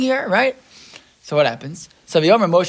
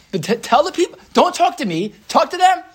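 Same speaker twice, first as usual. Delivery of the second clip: impatient God; 11 semitones higher than usual. Retold or imitated. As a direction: snap the order like a fed-up God. God rebuking Moshe.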